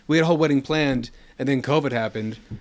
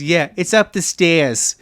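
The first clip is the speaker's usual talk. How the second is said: slow New York drawl on stairs; a New York mom giving directions; New York accent; 3 semitones higher, 5 dB louder, slower than usual. Retold or imitated. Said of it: "that is the accent I grew up with".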